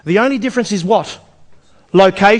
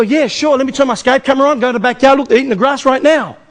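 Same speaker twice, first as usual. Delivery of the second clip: higher, playful leg-pulling; teasing American students, higher and playful; mock deadpan; 6 semitones higher, 4 dB louder than usual. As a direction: sell the fake kangaroo claim with a straight face. Teasing students about backyard kangaroos.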